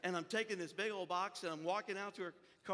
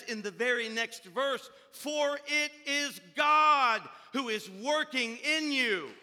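loud, driving sermon delivery; full-force preaching; 3 semitones higher, 7 dB louder than usual. Real, not acted. Pastor urging the congregation toward transforming love, full-force preaching. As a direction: press each point home, swelling to a shout.

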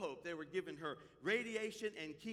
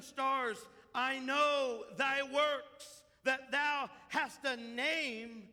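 slow, weighty King James reading; measured pulpit reading of scripture; solemn scripture reading; 4 semitones higher, 5 dB louder, slower than usual. Real, not acted. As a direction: lay each word down heavily.

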